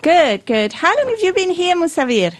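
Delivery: ordinary talk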